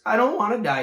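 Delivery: high, loud moaning wail; moaned. Acted. Wilbur moaning in tears to Charlotte. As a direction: moan it out in despair. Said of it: "he moaned".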